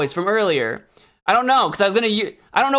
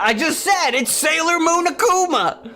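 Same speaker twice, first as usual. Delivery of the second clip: exasperated high shout; 10 semitones higher than usual. Acted. Insisting after being asked.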